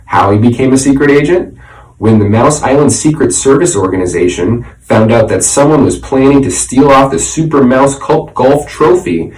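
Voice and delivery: ordinary delivery, nothing marked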